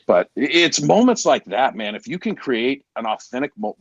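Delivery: ordinary talk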